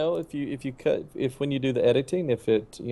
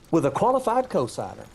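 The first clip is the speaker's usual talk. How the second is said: prim loan officer; 6 semitones higher than usual. Retold or imitated. Retold loan officer's condition.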